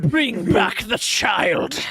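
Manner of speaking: slow drawn-out spirit command; haunting demand, stretched slow; ghostly voice. Acted. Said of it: "ghostly fucking voice".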